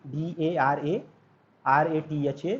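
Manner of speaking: letters D A R A, R A T H A spelled one by one, slowly; spelling out. Shown as a demonstration. Teacher spelling out the case name for students, letter by letter. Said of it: "क्या pronounce करते हैं आप लोग".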